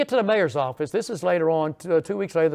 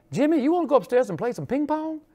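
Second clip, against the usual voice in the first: higher, lightly voiced elderly lady inviting; quoted old lady; 9 semitones higher, 3 dB louder than usual. Elderly hostess inviting Jimmy upstairs to ping pong, high lightly voiced.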